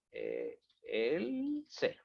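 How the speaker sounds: slow, stretched "else"; drawn out. Real, not acted. Teacher typing code for the class, drawn out. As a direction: drag out "else" while typing it. Drawing the word out while typing.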